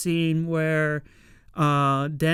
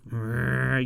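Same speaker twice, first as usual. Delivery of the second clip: low angry growl; 5 semitones lower than usual. Retold or imitated. Gota's angry look.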